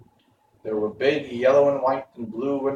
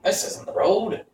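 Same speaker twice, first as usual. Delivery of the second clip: higher bird voice; a sharp-eyed stork, pitched up; 9 semitones higher than usual. A narrator's reading. Stork correcting the travellers, higher voice. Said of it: "said the stork".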